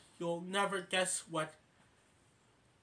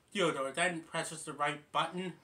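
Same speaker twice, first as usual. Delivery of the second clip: ordinary talk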